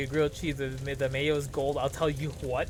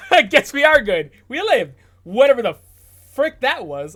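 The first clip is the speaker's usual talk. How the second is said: loud relieved outburst; 6 semitones higher, 11 dB louder than usual. Real, not acted. Relief after the fire scene.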